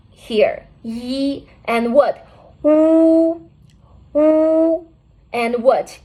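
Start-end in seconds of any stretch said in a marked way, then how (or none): 2.6-4.9 "Wu" held long and loud on a level oo; drawn-out, louder model "wu"; demonstrated vowel. Shown as a demonstration. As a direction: sustain each "wu" high and steady for learners. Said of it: "Listen to me".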